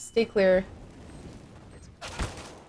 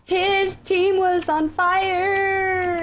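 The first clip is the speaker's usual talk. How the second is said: excited; 8 semitones higher, much slower than usual. Real, not acted. Excited correction after teammate.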